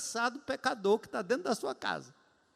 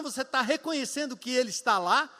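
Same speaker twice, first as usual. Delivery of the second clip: ordinary talk, nothing marked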